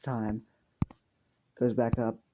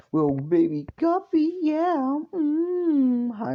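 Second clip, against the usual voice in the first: put-on much higher, cooing baby talk; baby talk to a kitten; 4 dB louder, slower than usual. [name] greets his cat Guppy, high cooing baby talk. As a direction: coo at the kitten like it's a baby.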